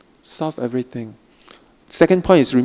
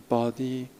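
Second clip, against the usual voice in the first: usual speaking voice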